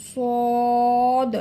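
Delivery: সদ loud, with a very long rounded vowel; thick letter name. Shown as a demonstration. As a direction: hold a full-mouthed "sooood" on সদ. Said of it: "মোটা করে উচ্চারণ করতে হবে". (সদ গাল ভরে লম্বা টেনে)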